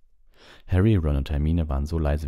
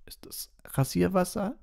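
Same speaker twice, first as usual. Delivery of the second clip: high, slow, strangled whisper; half-choked. A narrator's reading. Hermine asks about Hagrid's awful scent, half-choked. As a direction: squeeze the question out as if half stifled. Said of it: "mit halb erstickter Stimme".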